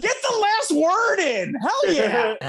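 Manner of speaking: loud, high, drawn-out cheer; cheering them on. Acted. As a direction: holler your support like a fan. Acted cheering of the survivors.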